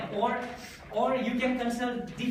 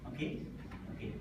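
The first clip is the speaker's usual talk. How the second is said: ordinary delivery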